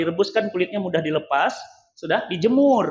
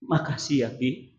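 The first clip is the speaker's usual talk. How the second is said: quiet, lower, tender thank you; whisper-soft gratitude of a wife; soft wife's thanks; 7 semitones lower, 7 dB quieter than usual. Retold or imitated. The wife quietly thanks her husband for the money.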